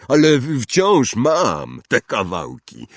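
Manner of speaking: loud, slow giant's voice; gruff giant, eager. Acted. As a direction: blurt it out loudly, stumbling at the start. (głośny olbrzym, z zająknięciem)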